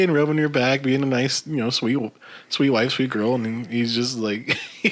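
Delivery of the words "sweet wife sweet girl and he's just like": ordinary talk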